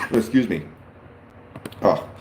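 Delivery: throat catching after a cough; hoarse, choked voice after coughing; choking. Real, not acted. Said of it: "I'm choking here".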